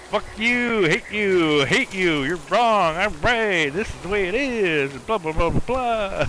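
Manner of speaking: high, mock-angry troll chant; mocking angry commenters. Streamer imitating hateful online commenters, high mock jeering. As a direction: parrot the online haters' jeering. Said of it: "It's always".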